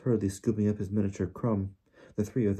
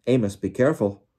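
raised-voice caution; warningly; 6 dB louder than usual. A narrator's reading. Wizards cautioning a colleague heading off, warningly. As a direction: call out a warning to him. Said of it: "said a few of the wizards warningly".

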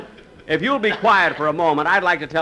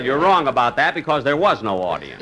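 normal talking voice

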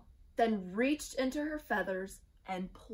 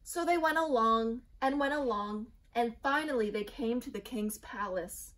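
ordinary delivery, nothing marked

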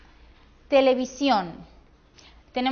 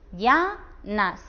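"Llanas" split in two, LLA stretched and rising, NAS dropping; stress on first syllable. Shown as a demonstration. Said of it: "la sílaba tónica está en esta". ("Llanas" por sílabas, alargando LLA)

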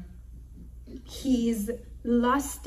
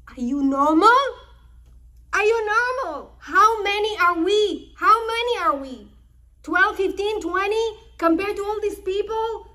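high, loud, frantic father scolding his sons; alarmed scolding father; 8 semitones higher, 5 dB louder than usual. Retold or imitated. Yaakov rebuking his sons for the killing, alarmed and loud. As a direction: cry out at them in disbelief.

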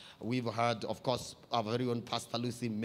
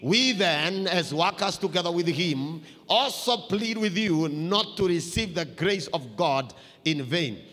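louder, higher group reading; read together; 6 semitones higher, 7 dB louder, slower than usual. Shown as a demonstration. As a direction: lead the church aloud through the verse. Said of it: "Can somebody read that verse with me?".

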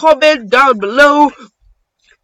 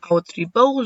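normal talking voice

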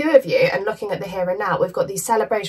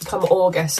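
ordinary delivery

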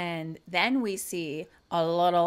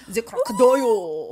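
"The crocodile" as "Zee crocodile", louder in a bad French accent; mock French accent; 7 dB louder than usual. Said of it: "You had it and then you lost it".

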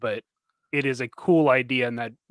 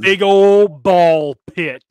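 very slow and loud; drawn-out; 5 semitones higher, 12 dB louder, much slower than usual. Pretend. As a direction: stretch each word out loud. Drawn-out joke chant.

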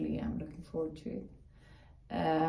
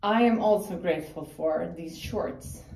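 ordinary talk, nothing marked

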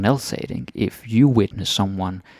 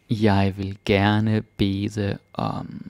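very slow; much slower than usual. Shown as a demonstration. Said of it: "slow teaching repeat".

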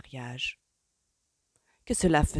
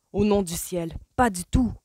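loud angry bark, pitched up; furious refusal, raised voice; 4 semitones higher, 5 dB louder than usual. A narrator's reading. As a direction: snap it out in outrage. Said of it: "aboya-t-il".

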